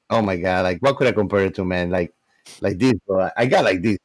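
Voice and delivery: usual speaking voice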